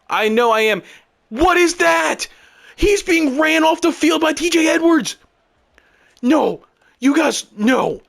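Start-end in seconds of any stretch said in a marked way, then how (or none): 1.3-8.0 high-pitched incredulous shout; outraged yelling. Real, not acted. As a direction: yell in disbelief at the game. Outraged protest at game glitch.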